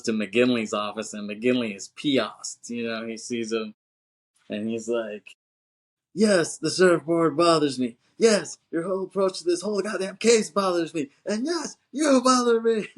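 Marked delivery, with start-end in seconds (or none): 6.1-12.9 rising to a high, loud angry rant; furious boss. Retold or imitated. McGinley berating Keanu in his office, building fury. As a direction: scold him, building on each yes.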